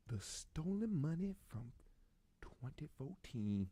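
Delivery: slow, hushed, drawn-out question; dramatic question. Real, not acted. Comedian asking viewers, slow and fading to a hush. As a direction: spin it out for suspense. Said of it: "I'ma ask you this question".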